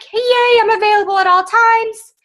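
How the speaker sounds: high, loud, sing-song hey yay; mock cheery availability. Mocking her too-eager post to the team, high sing-song cheer. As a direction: chirp it like you're always on call. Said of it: "it made it look like".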